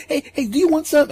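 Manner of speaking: put-on very high, eager kid chatter; little kid voice. The boy greets everyone while offering food, very high and eager. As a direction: bounce it up high like an excited kid.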